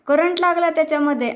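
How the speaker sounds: a hammed-up startled yelp of words; mock electric shock. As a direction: blurt it out as a current hits you.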